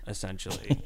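ordinary talk

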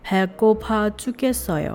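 quick, run-together phrase; fast read. Shown as a demonstration. Teacher showing the fast version to students. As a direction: rattle it off naturally. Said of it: "เวอร์ชันพูดเร็วเนี่ย".

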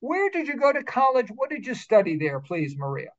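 usual speaking voice

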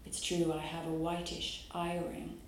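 usual speaking voice

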